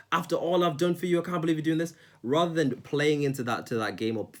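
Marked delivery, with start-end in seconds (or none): 0.1-1.9 mock guilt trip. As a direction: act wounded to make them feel bad. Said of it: "So when somebody says".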